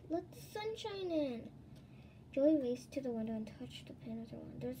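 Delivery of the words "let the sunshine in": eager cry. Acted. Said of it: "Kirsty cried".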